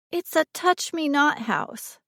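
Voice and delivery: put-on high, slow, distinct child's voice; little girl speaking clearly. A narrator's reading. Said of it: "she said clearly".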